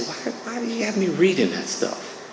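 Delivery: high, soft mock whine; retold complaint. Newcomer questioning his sponsor about the reading, complaining. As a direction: protest in a small, high-pitched voice.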